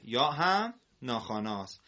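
normal talking voice

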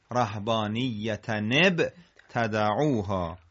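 slowly linked "rahbaaniyyata-neb"; measured repeat with "neb" clearly sounded; joined tanween. Shown as a demonstration. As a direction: carry the N into نب, then تدعوها.

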